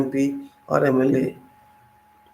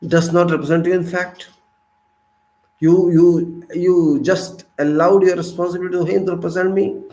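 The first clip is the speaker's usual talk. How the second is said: normal talking voice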